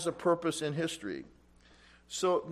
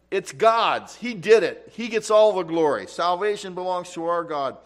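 loud, ringing shout of praise, higher; loud cry of praise; 3 semitones higher, 9 dB louder than usual. Acted. Preacher voicing the crowd crying out praise to God, loud. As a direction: call it out like a worshipping crowd. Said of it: "Crying out with a loud voice".

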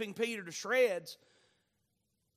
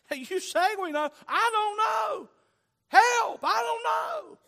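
hammed-up shout, climbing very high; loud, high-pitched mock desperation, words stretched out; panicked protest; 13 semitones higher, 9 dB louder than usual. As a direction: cry out for help as a baffled Thomas. Acted Thomas protesting he is lost.

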